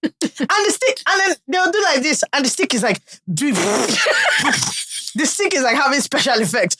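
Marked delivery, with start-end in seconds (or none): 3.3-6.8 slow, laughing through the words; laughing slowly at the memory. Real, not acted. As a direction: drag it out while cracking up. Laughing description of cane.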